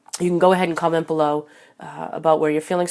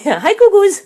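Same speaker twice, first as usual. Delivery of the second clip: very high, loud squeal of a nickname; squealy cat greeting; 10 dB louder than usual. Real, not acted. Host greeting her cat by nickname, loud high squeal. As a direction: squeal Goo Goos at the cat.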